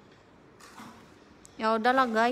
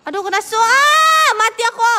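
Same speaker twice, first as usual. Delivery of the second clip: panicked yelp; 9 semitones higher, 8 dB louder than usual. Real, not acted. Getting killed in the game.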